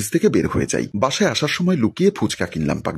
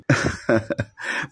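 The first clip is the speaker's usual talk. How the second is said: breathy soft chuckle; a quiet knowing laugh; 5 dB quieter than usual. Acted. Father laughing after his advice.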